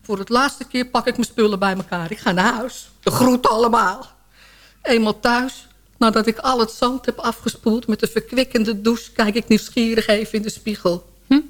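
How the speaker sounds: usual speaking voice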